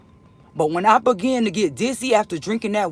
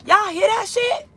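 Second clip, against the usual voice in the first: imitating upstairs voices; 11 semitones higher than usual. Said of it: "as if they were saying".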